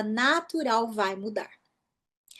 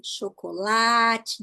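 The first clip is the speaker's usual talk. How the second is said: very slow "chocolate", LA held long; stressed penultimate syllable; much slower than usual. Shown as a demonstration. Teacher pointing out the longest syllable, "chocolate" stretched on LA. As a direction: linger on LA in "chocolate". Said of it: "a penúltima sílaba é a sílaba que eu demoro mais".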